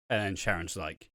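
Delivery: ordinary delivery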